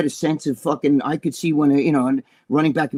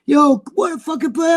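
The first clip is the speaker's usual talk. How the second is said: high shouted praise; hyped teammates; 9 semitones higher, 4 dB louder than usual. Retold or imitated. Teammates rushing to congratulate him, high hyped shout. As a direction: cheer like a pumped teammate. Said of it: "the guys are coming up to me".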